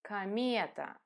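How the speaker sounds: "Комета" as ka-MYE-ta, reduced O; simplified natural pronunciation. Shown as a demonstration. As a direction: say "Комета" as one word, stress on the middle syllable. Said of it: "simplify its pronunciation and say".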